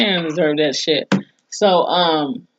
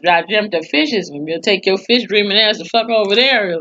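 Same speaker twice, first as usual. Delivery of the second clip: ordinary delivery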